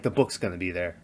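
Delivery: quietly predicting the scary part; hushed anticipation. Retold or imitated. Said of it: "I was like".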